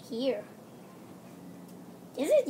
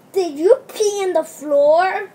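loud, slow, higher scolding question; mock scolding; 4 semitones higher, 11 dB louder than usual. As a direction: play an outraged mommy. Mommy scolds doll.

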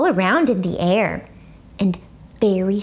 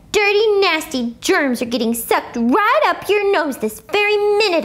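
put-on higher, bossy girl scolding; a hint of tattletale May; bossy warning; 8 semitones higher than usual. A narrator's reading.

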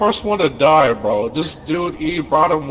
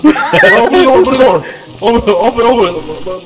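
very loud, repeated demand; frantic yelling; 11 dB louder than usual. Real, not acted. Player demanding the door be opened, frantic yelling. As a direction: bellow it urgently at the door. Trapped at door during chase.

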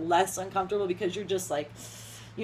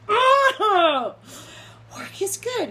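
high sharp yelp, then a strained high answer; pained yelp into forced reply; 9 semitones higher, 6 dB louder, much slower than usual. Retold or imitated. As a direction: cry out, then push the words through the pain. Laser snap during small talk.

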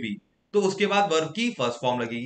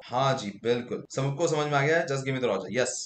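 ordinary delivery

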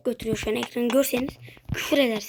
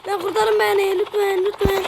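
higher, louder frightened begging; scared pleading; 7 semitones higher, 4 dB louder than usual. Real, not acted. Player begging to be saved, frightened. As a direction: plead for rescue in fear.

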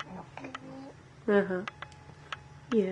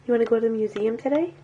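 ordinary talk

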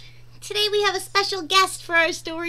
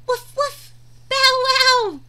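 puppy bark; 8 semitones higher, 6 dB louder, slower than usual. Acted. Voicing the puppies.

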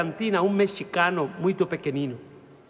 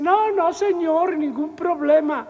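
nervous backing down; 11 semitones higher, slower than usual. Acted. Little man backing down before the gunman, high and scared. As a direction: back down fast, voice up high.

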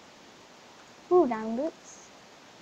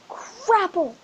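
high, loud minced curse; frustrated yelp; 8 semitones higher, 11 dB louder than usual. Real, not acted. Gamer taking a hit in Skywars, loud frustrated yelp. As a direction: yelp it out at the screen.

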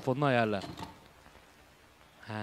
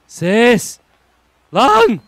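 loud burst testing the mic; shout; 8 semitones higher, 17 dB louder than usual. Real, not acted. Streamer shouting into the mic to test it. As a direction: yell at the microphone.

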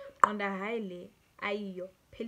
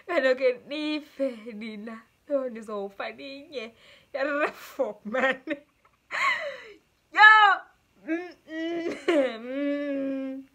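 talking through real laughter; helpless giggles breaking into the words; can't stop laughing; 4 semitones higher, 8 dB louder, much slower than usual. Real, not acted. Vlogger overcome by a confession about men, laughing as she talks. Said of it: "I can't stop laughing".

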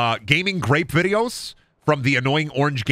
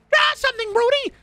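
shrill squeal. Pretend. Mocking the chat with a shrill squeal. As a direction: screech it like a shrill cartoon kid. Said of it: "put more screaming shill into".

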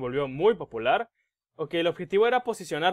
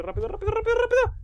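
much higher, panicky hurry-up squeal; frantic urging; faster than usual. Real, not acted. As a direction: urge yourself on in a frantic rush. Rushing while the killer approaches.

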